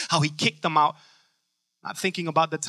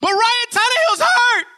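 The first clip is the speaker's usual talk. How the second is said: high-pitched ranting sports fan; top-of-lungs screaming; 4 dB louder than usual. Acted. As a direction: scream it in comic outrage. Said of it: "screaming at them at the top of the lungs".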